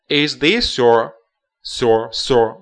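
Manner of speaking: "this your" blended, slow; linking this your. Shown as a demonstration. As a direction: slowly join "this" and "your". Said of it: "要这么读啊". (这么连读"your")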